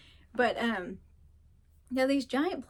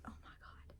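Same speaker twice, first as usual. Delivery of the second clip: mock exasperated whisper; 18 dB quieter than usual. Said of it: "I am like".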